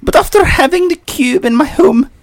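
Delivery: Professor McGonagall. Acted. Co-host reviewing the cube as the professor, McGonagall. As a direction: deliver it high and measured as a schoolmistress. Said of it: "Take it away, Professor McGonagall".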